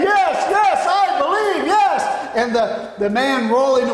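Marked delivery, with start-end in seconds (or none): 0.0-2.4 high-pitched, eager cries of belief; excited shouting. Retold or imitated. Excited spectator answering the tightrope walker, excited shouting. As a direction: shout it like an overexcited front-row fan. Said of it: "one man was so excited".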